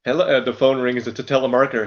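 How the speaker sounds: ordinary talk, nothing marked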